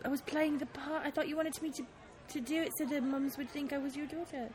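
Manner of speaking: soft, small-voiced protest; murmured self-defence that speeds up; sheepish excuse. Scolded partner explaining she played the daughter, softly sheepish.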